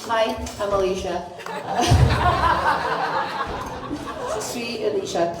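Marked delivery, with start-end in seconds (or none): 1.3-4.3 breathy embarrassed giggle, pitched up; a short nervous laugh before speaking; shy laugh. Real, not acted. Introducing herself, laughs shyly. (petit rire gêné)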